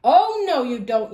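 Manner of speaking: grandma yelling at the wolf from the doorway; yelled. Acted. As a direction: burst in yelling to stop him. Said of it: "yelled a familiar voice".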